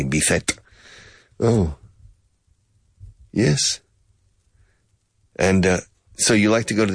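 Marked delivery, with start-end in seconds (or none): 0.0-0.6 "Bizet" said Spanish-style as "bee-THET"; "Bizet" pronounced as a Spanish speaker would; Spanish pronunciation. Shown as a demonstration. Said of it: "Or as you say".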